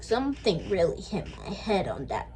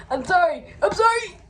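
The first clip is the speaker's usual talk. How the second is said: rising, pleading sorry, higher and louder; panicked apology; 9 semitones higher, 7 dB louder than usual. Acted. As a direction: beg frantically for forgiveness. Begging forgiveness, rising panic.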